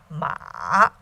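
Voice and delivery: MA low, dipping then rising; third tone. Shown as a demonstration. Teacher recapping four tones, dipping MA. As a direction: dip MA to the bottom and lift it. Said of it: "third tone".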